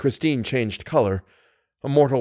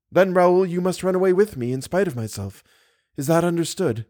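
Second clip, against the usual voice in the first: put-on higher-pitched young woman pleading firmly; insistent heroine; 7 semitones higher than usual. A narrator's reading. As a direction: press him in the heroine's raised voice. Voices Christine.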